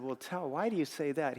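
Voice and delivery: hushed, careful question; retold soft, concerned asking; gentle concern. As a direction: ask a troubled friend softly. Gently asking a troubled friend.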